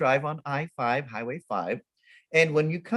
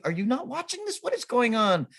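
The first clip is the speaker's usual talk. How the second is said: high-pitched exasperated disbelief; acted incredulity; 9 semitones higher than usual. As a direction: question it in a high, mock-appalled voice. Acted disbelief at inattentive mom.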